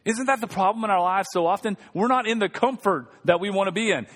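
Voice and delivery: normal talking voice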